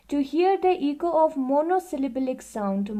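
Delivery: reading the book aloud, very slowly and evenly; slow classroom read-aloud; slow textbook reading. Acted. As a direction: dictate it word by word to the class. Reads textbook line aloud.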